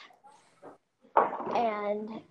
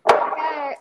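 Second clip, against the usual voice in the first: loud eager okay; 7 semitones higher, 11 dB louder than usual. Retold or imitated. Performers agree eagerly.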